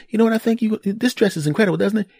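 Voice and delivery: high-pitched mock wife voice; imitated wife. Voices wife showing her dress.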